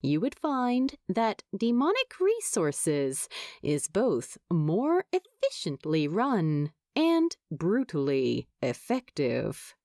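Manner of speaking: ordinary delivery, nothing marked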